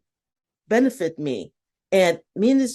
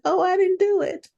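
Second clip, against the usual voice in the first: much higher, breezy mock-innocent coworker; smug coworker; 10 semitones higher than usual. Older coworker shrugging off his missing report, breezy and high. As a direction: toss it off like it's nothing. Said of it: "And he would smile about it".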